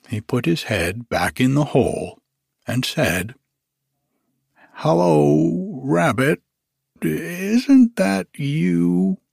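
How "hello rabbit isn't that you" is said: higher, louder, slow coaxing bear; Pooh voice. Acted. Pooh calls Rabbit in hole, slow hopeful bear voice. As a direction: call in hopefully and slowly.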